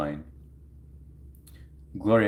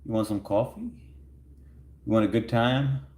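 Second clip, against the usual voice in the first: cheesy pickup line; 5 semitones higher than usual. Acted. Coaching a chatter on asking out a crush, with a hammed-up sleazy pickup line. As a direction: ham up a sleazy flirt to get a laugh. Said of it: "Just be like".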